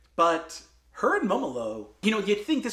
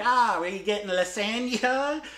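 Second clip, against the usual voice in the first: high Midwestern aunt voice; Midwestern accent; 7 semitones higher than usual. Retold or imitated. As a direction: imitate a Midwestern aunt asking. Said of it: "she's like".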